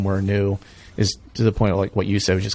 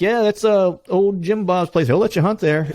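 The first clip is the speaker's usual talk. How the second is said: friendly local; 9 semitones higher, 3 dB louder than usual. Retold or imitated. Voicing local about Jim Bob.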